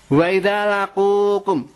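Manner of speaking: slow chanted Arabic verse, drawn out; slow Quran recitation. Acted. Preacher intones verse to the congregation, slow recitation. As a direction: intone "Wa idza laqukum" slowly, like a Quran reciter.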